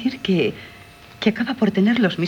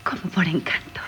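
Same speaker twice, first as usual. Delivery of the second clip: hushed wonder; 3 semitones lower, 4 dB quieter than usual. Acted. Wondering echo of his words.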